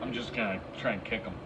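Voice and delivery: hiccuping while talking; with hiccups. Real, not acted. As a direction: speak through the hiccups. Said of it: "Sorry about the hiccups".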